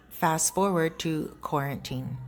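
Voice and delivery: ordinary talk, nothing marked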